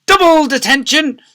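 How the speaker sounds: high, furious bellow. A narrator's reading. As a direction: bellow over every protest. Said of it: "bellowed Miss Seeth".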